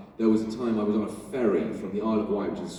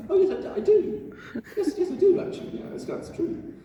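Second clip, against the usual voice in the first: flustered realization. Retold or imitated. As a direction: caught out, laughing, yes I do.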